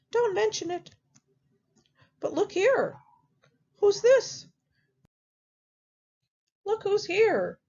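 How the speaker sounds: squeaky high piggy voice, chatty; a pig welcoming guests, high and bright; high little pig voice. Acted. Third pig voiced in story.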